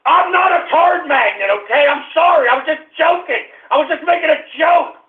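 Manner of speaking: high-pitched protesting outburst; defensive yelling. Real, not acted. Host insisting he was just joking, defensive yelling. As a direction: protest at full pitch, worked up.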